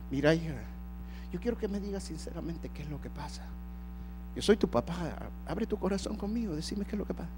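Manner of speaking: soft, low and gentle fatherly coaxing; a father softly begging his daughter to open up; tender father. Retold or imitated. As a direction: coax the truth out of her quietly.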